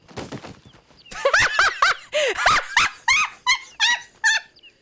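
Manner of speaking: shrieking high burst of laughter; loud laugh. Real, not acted. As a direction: cackle out loud at the end. Laughs after story ends.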